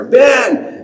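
high, loud call up the stairs; shouting a name. Retold or imitated. Father calling his son from upstairs, loud shout. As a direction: yell his name downstairs. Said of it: "I'm shouting at the top of my lungs".

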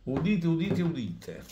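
proclaiming like a town crier. Acted. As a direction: announce it like a herald. Tipster announcing his lottery prediction.

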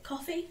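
COFFEE lifted high at the end, as a question; questioning offer. Acted. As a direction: offer "Coffee?" softly with a rising tune. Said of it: "coffee with a question mark".

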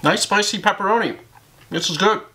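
chewing while talking; talking between bites; mouth full of pizza. Real, not acted. Seen 0: Bites at -9, jaw and cheeks keep chewing through 10.9.

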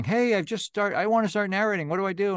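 high, eager beginner voice; mock new narrator pleading for help, high and eager; eager newbie. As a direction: pitch it up like a clueless newcomer. Mocking newcomers asking for help.

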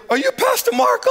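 very high, mock whimpering question; imitated tearful man. Caught attacker recognizes the pastor, high tearful mimicry. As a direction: squeak it out as a mock crybaby. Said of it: "he just started crying".

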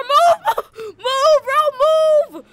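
high, loud yell to clear the way; urgent shout. Pretend. Driver stuck with things on the car, shouting urgently. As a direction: holler it, stretched and exasperated. Yelling at people blocking the car.

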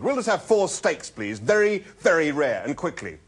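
loud, impatient, commanding order; snapping order. A narrator's reading. Zaphod ordering steaks, snapping. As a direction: order briskly, fed up with debate. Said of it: "snapped Zaphod".

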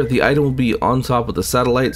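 ordinary talk, nothing marked

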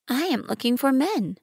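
little prince. Acted. Narrator voicing little prince.

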